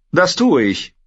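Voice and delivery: high, decisive queen; firm resolve. Acted. Dany cuts off Jorah, high and decisive. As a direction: answer sharply and certain. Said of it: "sagte Dani plötzlich sehr entschieden".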